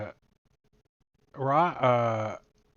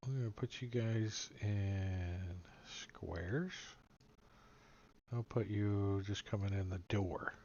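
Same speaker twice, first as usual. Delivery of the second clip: whispering; 3 semitones lower, 10 dB quieter than usual. Real, not acted. GM aside while placing tokens.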